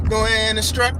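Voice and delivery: normal talking voice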